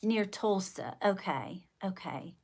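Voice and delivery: normal talking voice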